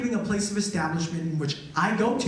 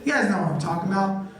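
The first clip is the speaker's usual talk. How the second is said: usual speaking voice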